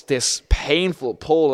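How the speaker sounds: ordinary talk, nothing marked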